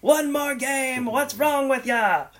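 hammed-up yell, dragging out each repeat; retold drunken scream. Robert Shaw yelling at his friend over ping pong, hammed-up high shout. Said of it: "screaming at the top of his lungs".

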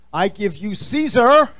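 a big show-host bellow for the next guest; ringside announcer. Acted. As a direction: belt the name like a boxing announcer. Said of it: "introduces guest".

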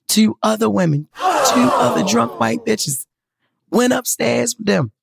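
high-pitched, drawn-out incredulous exclamation; scandalized disbelief. Real, not acted. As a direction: raise the pitch in appalled amazement. Scandalized at women going upstairs.

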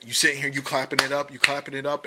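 fast, loud and hyped; excited. Retold or imitated. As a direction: rush it out, hyped. Mimics clapping player.

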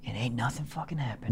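whispered disappointed deadpan. Real, not acted. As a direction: let it die in a whisper. Complaint about fake endings.

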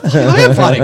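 loud retold inner protest; big mock-frustrated outburst; exasperated inner voice. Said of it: "I'm saying that to myself".

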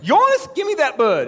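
Scotty's Maine voice. Retold or imitated. Storyteller imitates Scotty ordering him to fetch the turkey, high Maine accent. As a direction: call the name high like Scotty would. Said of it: "without doing his voice".

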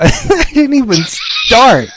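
slow, high, laughing through it. Real, not acted. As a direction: laugh while admitting it. Laughing at own procrastination.

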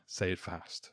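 "say it fast" said low and brisk against the slow take; quick, low teacher contrast. Shown as a demonstration. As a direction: drop the pitch and clip "say it fast". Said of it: "this difference between say it".

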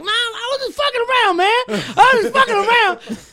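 very high, loud squealing plea; panicked mugger. Retold or imitated. The mugger backing down, squealing very high and loud.

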